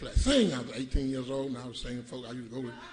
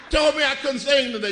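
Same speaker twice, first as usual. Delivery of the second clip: tattle it louder and higher; louder whiny complaint; 6 semitones higher, 5 dB louder than usual. Retold or imitated. Boy tattling to pastor, louder and higher. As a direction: press the grievance like a wronged boy.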